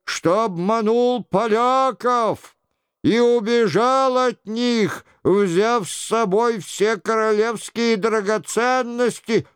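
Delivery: high-pitched mock-royal confession; sing-song show of repentance; imitating the king. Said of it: "продолжал за Генриха Шико".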